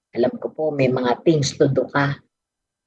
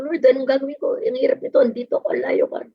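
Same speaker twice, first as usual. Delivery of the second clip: a touch of retold desperate plea, higher; pleading to the Lord, remembered; retold prayer; 9 semitones higher, faster than usual. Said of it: "Tapos sabi ko". (bahagyang nagmamakaawang panalangin)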